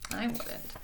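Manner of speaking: ordinary delivery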